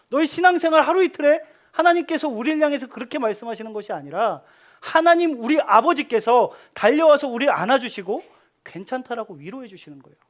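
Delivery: ordinary talk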